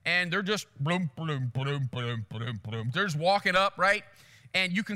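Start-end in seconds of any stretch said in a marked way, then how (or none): 1.2-2.9 low, soft rhythmic trudging noise; lumbering buffalo footsteps, voiced low; imitated plodding hooves. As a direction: sound out heavy footfalls, low and steady.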